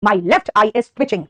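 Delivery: very slow, each word spaced for students; slow, careful classroom delivery; slow model sentence. Shown as a demonstration. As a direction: spell it out slowly like a teacher. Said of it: "ठीक है, ये सब काम की चीजें बेटा".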